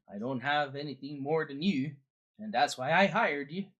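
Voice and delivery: put-on Swedish-Canadian accent; lilting Swedish-Canadian character voice; Credence. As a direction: play a folksy Swedish-Canadian boatman. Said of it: "I'm good old Swedish Canadian".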